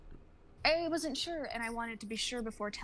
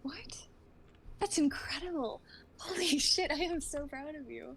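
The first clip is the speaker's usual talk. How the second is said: higher, bursting with joy; excited delight; 6 semitones higher than usual. Acted. Vaughn thrilled at her friend's news, excited. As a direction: gush with pride.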